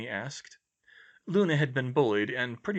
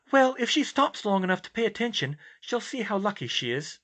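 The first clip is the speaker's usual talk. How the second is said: put-on lightly raised girl voice; young woman's wry thought; Hermione voice; 10 semitones higher, 4 dB louder than usual.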